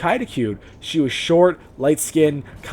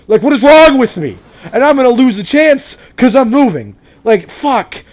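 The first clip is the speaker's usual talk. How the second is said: loud, much higher, frustrated self-scolding; exasperated rant; 6 semitones higher, 8 dB louder than usual. Real, not acted. Vlogger scolding himself about never taking cosplay photos, loud. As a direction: kick yourself out loud for wasting the chance.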